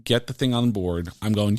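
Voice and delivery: normal talking voice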